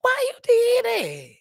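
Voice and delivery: slow, high, drawn-out mocking why; sarcastic high-pitched drawl; mock high question.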